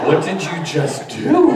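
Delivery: loud, slow, laughing disbelief; imitated shock. Children after therapist ate Play-Doh, comic shock. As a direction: stretch it out loudly like an astonished kid. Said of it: "they both looked at me like".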